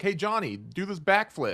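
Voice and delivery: mock egging Johnny on to a stunt; goading crew. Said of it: "they're like".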